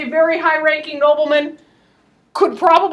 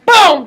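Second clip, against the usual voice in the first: vocal gunshot; 3 semitones higher, 10 dB louder than usual. Retold or imitated. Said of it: "gunshot sound".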